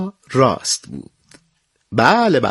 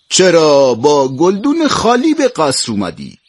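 slightly raised, louder storybook emperor voice; lightly voiced emperor demanding an explanation; questioning emperor; 5 semitones higher, 5 dB louder than usual. A narrator's reading. Emperor asks the child about an empty flowerpot, questioning emperor voice.

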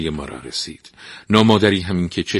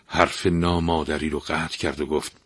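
plain reading voice

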